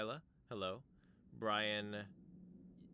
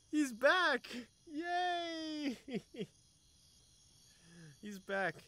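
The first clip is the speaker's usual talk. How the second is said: much higher and louder squeal of joy; excited cheer; 5 dB louder, much slower than usual. Real, not acted. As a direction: squeal it out, thrilled he's back. Character returns, streamer cheers.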